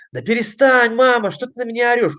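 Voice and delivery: put-on high-pitched, quick child's protest; irritated child. Child telling mum to stop yelling, high-pitched irritated protest. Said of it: "которой нам говорит ребёнок".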